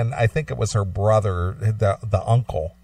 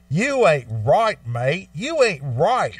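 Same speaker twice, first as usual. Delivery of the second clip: mock Australian; 4 semitones higher, slower than usual. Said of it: "He goes".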